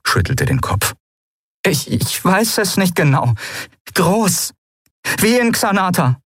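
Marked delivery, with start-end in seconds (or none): 1.6-6.2 high voice, hesitant start; anxious, urgent. Acted. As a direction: stammer into an uneasy answer. Lien answers, frightened and unsure. (ängstlich und unsicher, hohe Stimme)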